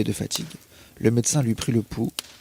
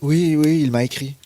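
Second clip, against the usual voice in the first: higher and louder hurried insistence, covering a lie; nervous lie; 4 semitones higher, 7 dB louder than usual. Acted. Cousin lying to the narrator about a letter, nervous insistence. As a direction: blurt the lie too eagerly. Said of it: "Donc, elle osa mentir".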